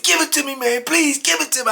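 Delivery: high-pitched urgent plea; retold frantic begging. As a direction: imitate someone desperately begging. Acted officer begging for knife.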